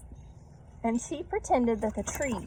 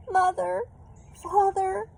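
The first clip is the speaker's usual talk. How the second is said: crying out; 11 semitones higher, much slower than usual. A narrator's reading. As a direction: call out for them, tearful. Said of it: "she cried".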